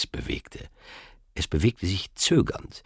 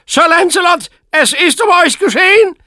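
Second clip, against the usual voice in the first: loud high taunting shout; triumphant shout; 10 dB louder than usual. A narrator's reading. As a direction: gloat at full volume. Agravain shouts through the door.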